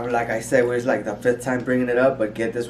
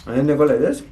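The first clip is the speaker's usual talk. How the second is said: loud, high giggling question; laughing tease; 4 semitones higher, 10 dB louder, faster than usual. Real, not acted. Mocking gesture, laughing.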